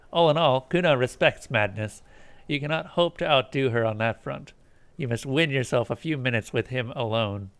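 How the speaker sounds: ordinary talk, nothing marked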